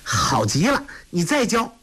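put-on high, childish joyful shout; a kid who loves the game; boy overjoyed after the punch; delighted cry. A narrator's reading. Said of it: "托雷大喜叫道".

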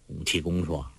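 usual speaking voice

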